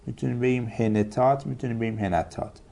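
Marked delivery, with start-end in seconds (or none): 0.8-1.5 هنتات said "he-ne-tat"; three syllables in هنتات, middle vowel E. Shown as a demonstration. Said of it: "سه وجه جایزه". 2.0-2.6 هنتات said "he-na-tat"; three syllables in هنتات, middle vowel A. Shown as a demonstration. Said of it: "سه وجه جایزه".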